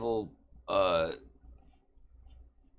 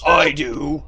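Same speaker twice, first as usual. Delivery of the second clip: loud, higher laughing yell; gleeful shout; 10 semitones higher, 9 dB louder than usual. Acted. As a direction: roar it out eager for a fight. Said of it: "Orcus goes".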